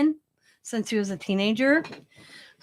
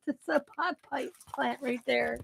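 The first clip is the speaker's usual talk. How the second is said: normal talking voice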